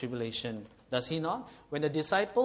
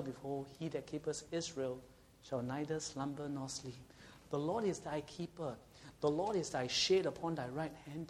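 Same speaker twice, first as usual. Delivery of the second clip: softer, hushed psalm recital; scripture reading; 7 dB quieter than usual. Acted. Pastor reciting a psalm to the congregation, soft reverent reading. Reads Psalm 121 aloud.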